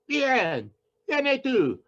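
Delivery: high, loud fairground barker calling the crowd; carny barker. Acted. Poet reading his monologue to the bar as a loud carny barker. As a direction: bark it out like a carnival huckster drawing a crowd. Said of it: "in the voice of a carny barker".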